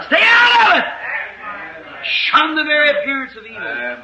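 slow, high, drawn-out shouted warning; thundering slow pulpit cry; preaching shout. Real, not acted. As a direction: hurl the warning at the congregation. Preacher warns against the devil's ground.

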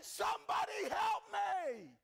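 high, drawn-out desperate shout; full-force acted cry for rescue; crying out for help. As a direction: scream it out slowly as a soul in torment. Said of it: "and he's still crying".

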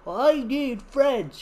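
high, slow, mopey little dino voice; sad dinosaur voice. Acted. Lonely young dinosaur telling its big friend, slow high whine. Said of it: "And he's like".

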